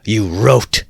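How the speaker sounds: a sharp jab at Luzin; said sharply. Acted. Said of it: "Raskolnikov said sharply".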